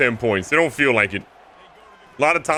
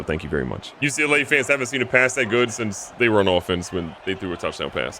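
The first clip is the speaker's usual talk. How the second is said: usual speaking voice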